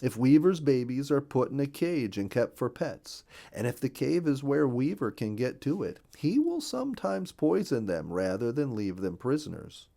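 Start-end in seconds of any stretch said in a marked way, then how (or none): none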